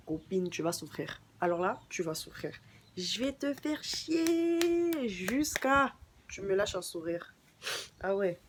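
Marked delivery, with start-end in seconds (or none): 3.0-7.3 playful threat. Pretend. Teasing threat to annoyed friend.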